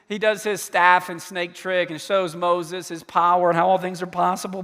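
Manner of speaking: usual speaking voice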